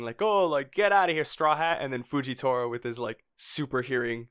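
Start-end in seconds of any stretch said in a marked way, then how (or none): 0.2-1.8 mild mock yell, pitched up; retold scream. Townspeople yelling at Straw Hat to leave, mock screaming. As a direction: imitate a crowd yelling, voice raised high. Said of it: "like, screaming".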